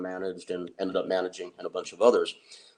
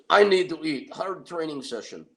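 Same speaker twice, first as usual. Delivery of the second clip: demanding Tony; 7 semitones higher, 6 dB louder than usual. Retold or imitated. Tony announces he must eat.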